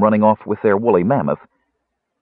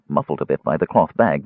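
plain reading voice

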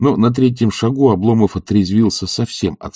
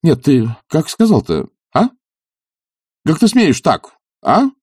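high-pitched, affronted demand; indignant scolding; 9 semitones higher, 3 dB louder than usual. A narrator's reading. Master rebuking his servant for rudeness, indignant. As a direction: take him to task, pitch raised.

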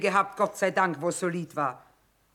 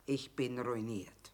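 dejected; 7 semitones lower, 11 dB quieter, slower than usual. Acted. Admits ruin to old friend.

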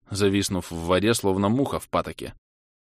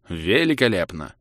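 snorting sarcastically. Acted. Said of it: "фыркнул я".

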